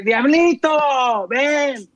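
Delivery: mock mom calling out high, drawn out; mamá llamando. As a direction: call the kid from the bathroom, stretching it. Madre llama al niño desde el baño. (mamá gritando al niño, aguda y alargada)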